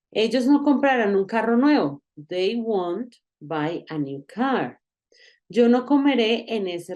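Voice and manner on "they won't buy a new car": slow model sentence. Shown as a demonstration. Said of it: "teacher models slow English sentence".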